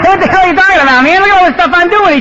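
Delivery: high, fast outraged protest; indignant retort. Retold or imitated. Newcomer protesting to an old-timer, indignant. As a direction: burst out defensively, pitch jumping up.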